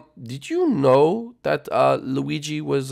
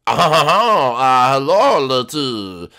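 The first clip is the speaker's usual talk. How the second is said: hammed-up French greeting, loud; attempted French accent; 6 dB louder, much slower than usual. Host greeting the guest in mock French accent. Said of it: "try French".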